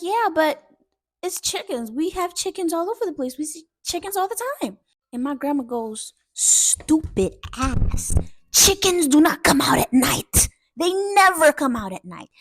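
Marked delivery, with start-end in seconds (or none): 0.0-4.8 put-on high, whiny kid's explanation; defensive protest. Dad explaining to grandma that chickens are everywhere, defensive. 6.3-12.3 loud, drawn-out mock outrage; slow down and hammer every word at a fool; exasperated scolding. Grandma scolding dad about chickens at night, exasperated.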